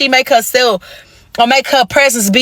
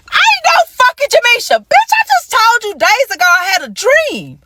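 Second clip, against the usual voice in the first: much higher, indignant retort; outraged high retort; 11 semitones higher than usual. Retold or imitated. Rejecting the wrong name from her man, high and outraged. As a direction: fire back offended at the wrong name.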